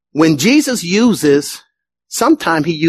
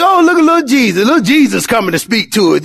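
high, fast mocking quote of the hometown crowd; mocking townsfolk; 3 semitones higher, faster than usual. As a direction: sneer like neighbours who knew him as a boy. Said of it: "they were like".

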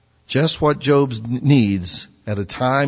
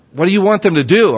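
ordinary delivery

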